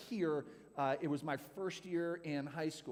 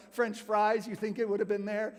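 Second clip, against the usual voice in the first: normal talking voice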